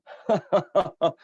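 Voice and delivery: high, loud burst of laughter; a string of high ha-ha laughs. Real, not acted. Host laughing after a joke, high loud laugh. Joking about who eats who.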